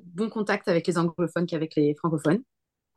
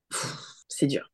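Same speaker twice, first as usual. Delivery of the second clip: scornful sigh; 3 semitones lower, 6 dB quieter, slower than usual. Real, not acted. Asked to pick a favourite.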